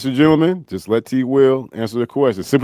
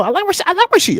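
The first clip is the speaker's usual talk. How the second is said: much higher, hammed-up coach greeting; Coach Raheem impression; much faster than usual. Impersonating the coach greeting T Will, high and hammy. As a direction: greet the player like a cheery mock coach. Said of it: "what would Coach Raheem of the Atlanta Falcons have to say".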